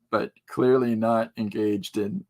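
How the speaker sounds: usual speaking voice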